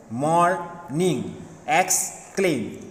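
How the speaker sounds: "Morning, exclaim" as mor... ning, ex... claim; split into two syllables. Shown as a demonstration. Teacher showing two-syllable words, each split by a pause. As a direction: stop mid-word in "Morning" and "exclaim". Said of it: "two time we stop".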